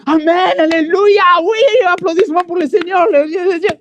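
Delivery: high, loud jubilant worship shout; ecstatic praise. Retold or imitated. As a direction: cheer it out like a revival crowd. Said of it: "Dans ma tête, ce moment-là, c'était".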